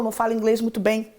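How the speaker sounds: usual speaking voice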